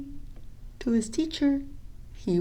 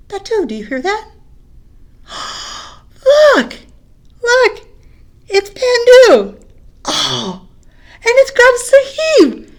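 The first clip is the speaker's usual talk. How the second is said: much higher and louder, breathless with delight; excited gasps; 12 semitones higher, 14 dB louder than usual. Acted. Boys spotting Pandu and Sahib, excited shouting. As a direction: point and gasp, thrilled to see them. Boys see friends arrive.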